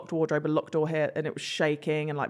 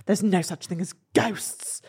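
hushed mum dismissing. Retold or imitated. Mum dismissing ghosts in story.

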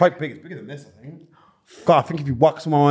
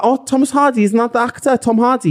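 higher-pitched Liverpool accent; Scouse impression; 7 semitones higher than usual. Shown as a demonstration. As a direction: keep up the Scouse, pitched high. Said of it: "I'm doing a Liverpool accent".

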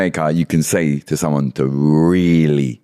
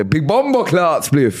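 high, drawn-out Jamaican exclamation; demonstrated slang exclamation; 7 semitones higher, slower than usual. Shown as a demonstration. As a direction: stretch it out slowly with relish. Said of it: "you can say".

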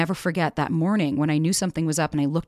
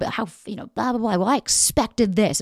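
hammed-up complaint, stretching the last words; mock heated argument; 4 semitones higher than usual. Partner raising expectations mid-argument, mock heated complaint. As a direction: imitate someone airing a grievance mid-fight. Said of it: "in the heat of an argument".

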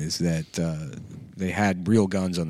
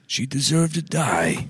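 slow, higher mock Baldwin menace; Alec Baldwin impression; 6 semitones higher, slower than usual. Host voices Baldwin with mock menace, slowly. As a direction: drag it out like a hammed-up villain. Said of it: "spoke as Alec Baldwin".

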